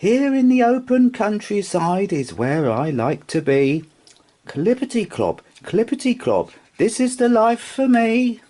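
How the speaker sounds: high, happy little rocking horse voice; a contented toy horse, sung out high; Sugarlump's high voice. Acted. Rocking horse content in the bedroom, high and happy.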